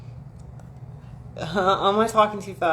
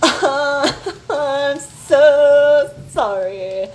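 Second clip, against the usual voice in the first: laughing apology; 6 semitones higher, 7 dB louder, much slower than usual. Real, not acted.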